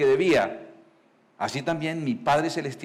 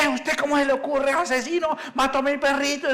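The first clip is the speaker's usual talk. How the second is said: put-on shocked, furious old man; outraged old owner; 10 semitones higher than usual. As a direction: cry out at the killer of his dog. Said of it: "Y le dice".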